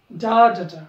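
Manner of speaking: डा, डा, डा hitting the first beat; stress rhythm. Shown as a demonstration. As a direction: beat out डा, डा, डा with a strong start. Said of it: "पहिल्याला जोरात म्हणा".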